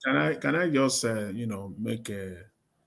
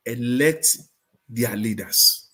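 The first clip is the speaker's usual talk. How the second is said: ordinary talk, nothing marked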